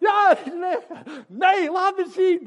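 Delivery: high, loud, laughing disbelief; excited disbelief. Retold or imitated. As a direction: cry out in amazement, laughing. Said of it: "zei ik". (luid en lachend ongeloof)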